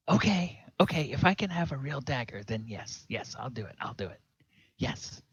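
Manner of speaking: put-on boy hurriedly giving in; eager young boy. Boy accepts the dagger bargain.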